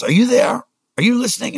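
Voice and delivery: imitating the COO shouting at him; mock screaming. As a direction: bellow it down the phone as the angry boss. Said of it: "he's screaming at me".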